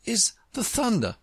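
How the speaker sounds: high-pitched, sure conclusion; very decided. A narrator's reading. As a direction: finish the answer as one quite certain. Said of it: "Alice said very decidedly".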